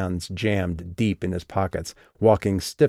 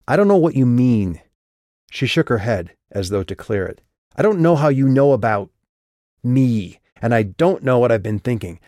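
louder, higher, rattled outburst; shaken; 4 semitones higher, 4 dB louder than usual. Acted. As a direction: panic breaking through. Shaken, rising panic.

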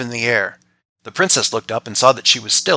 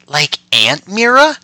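put-on child voice; 7 semitones higher, 8 dB louder, slower than usual. Father imitating child's question.

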